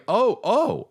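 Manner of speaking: retold weepy squeak, slow at the end; choked-up crying voice. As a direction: caught off guard and welling up. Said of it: "I start tearing up".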